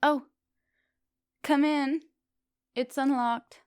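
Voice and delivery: high, loud call to someone outside; calling through door. Acted. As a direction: raise the voice so it carries through the door. Calls through closed door.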